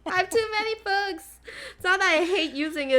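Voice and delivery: ordinary talk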